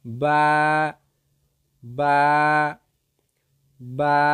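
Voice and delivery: বা from the wet part of both lips, long level vowel; sustained letter-drill বা, pausing after each; demonstrated letter sound. Shown as a demonstration. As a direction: model বা drawn out for learners. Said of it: "ভিজা জায়গা হইতে উচ্চারিত হইবে".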